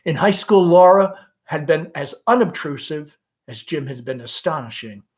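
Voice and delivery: ordinary talk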